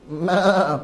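two drawn-out goat bleats. Acted. Teacher introducing a story about a goat, imitated bleating.

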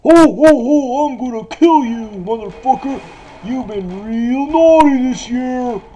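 Santa voice. Pretend. Gamer playing Santa to his victims, hammed-up evil Santa. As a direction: growl threats as a killer Santa.

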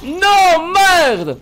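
high, loud drawn-out curse; dismayed shout. Real, not acted. Player cursing a setback in a fight, dismayed shout. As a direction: yell out in frustration.

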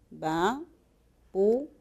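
slow, separate syllables VA and PU. Shown as a demonstration. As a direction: break off "va" and "pu" one at a time. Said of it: "de silabeo".

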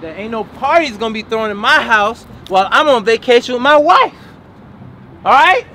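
building to a high, loud bark; stern dad turning drill sergeant; angry scolding. Acted. Dad scolds kids over party.

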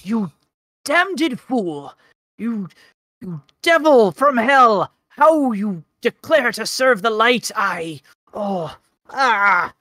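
high, slow, furious tirade; hammed-up distraught fury, words drawn out; distraught ranting. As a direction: curse him out, breaking into anguished groans. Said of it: "He's quite distraught".

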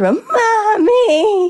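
high, drawn-out wail for mommy; hammed-up tearful whine; mock crying. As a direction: mimic a big baby bawling. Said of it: "so he's crying".

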